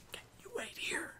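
hushed, breathy whisper, sneaking up on a house; whispered question. Retold or imitated. Richard whispers to Kalan to wait behind. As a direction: whisper it so nobody inside hears. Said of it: "of Richard going".

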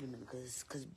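sheepish whisper; embarrassed voiceless whisper. Real, not acted. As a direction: confess it under your breath, no voice. Embarrassed aside.

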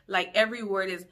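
ordinary delivery

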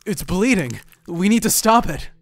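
high, loud, anxious urgency; urgent alarm. Acted. Companion seeing blood from an eye, urgent alarm. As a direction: press for help, loud and worried.

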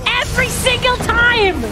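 high-pitched exasperated cursing; frustrated outburst. Real, not acted. Player hit by it every single time, frustrated outburst. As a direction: burst out in exasperation. Dying to the same attack.

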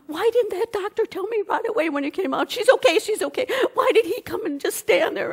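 high, loud, tearful mom voice; laughing and crying. Retold or imitated. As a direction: squeal it like a relieved crying mother. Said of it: "she's laughing and crying".

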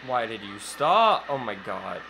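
ordinary delivery

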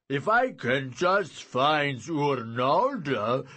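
slow, loud giant voice; simple, weary giant. A narrator's reading. Giant offers Merlin another plan, weary. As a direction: plod through the plan as a giant.

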